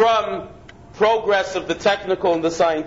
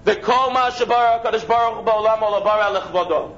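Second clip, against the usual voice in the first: a touch of liturgical reading; Hebrew recitation. Shown as a demonstration. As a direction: chant the Hebrew text in a recited lilt. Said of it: "it says".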